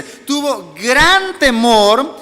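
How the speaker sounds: "gran temor" drawn out slowly; emphatic stress. Shown as a demonstration. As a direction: stretch "gran temor" with weight, stressing how great it was. Preacher corrects milder readings of verse. (énfasis lento en "gran temor")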